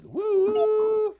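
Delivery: high drawn-out "woo"; train whistle. Acted. Train comes to feed kitty medicine, high whistle hoot. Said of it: "here comes a choo-choo train".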